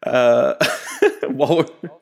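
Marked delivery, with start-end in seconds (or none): 0.6-1.2 sharp burst of high laughter. Real, not acted. Host cracking up at the cohost's joke, high laugh. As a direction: crack up suddenly.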